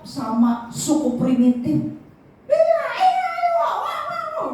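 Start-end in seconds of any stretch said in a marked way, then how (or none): 2.5-4.5 very high, whooping cries. Acted. Tribesmen whoop over their captive king, very high.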